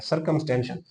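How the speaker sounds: normal talking voice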